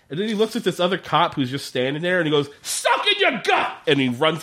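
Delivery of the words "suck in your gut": high, sharp yelled command; barked order. Retold or imitated. Kolchak yelling at a standing cop, high, sharp yelled order. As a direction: bark it at the cop.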